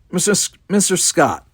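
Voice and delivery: high, loud summons; captain calling out. A narrator's reading. Kirk calling his engineer for shields, commanding. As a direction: call sharply for the engineer.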